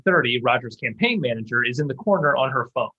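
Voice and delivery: normal talking voice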